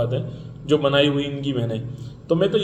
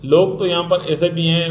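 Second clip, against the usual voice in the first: usual speaking voice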